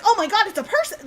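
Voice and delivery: quick, high, startled realisation; retold alarm. Storyteller replaying seeing it move, mock-alarmed realisation.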